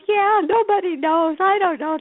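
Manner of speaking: half-crying retelling, voice up high; tearful voice. Retold or imitated. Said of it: "she started to weep".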